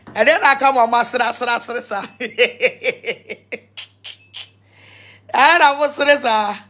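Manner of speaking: loud, words breaking into laughter; laughing through words. Real, not acted. Pastor on TV laughing at his own joke, loud and breaking up. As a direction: push the line out while laughing.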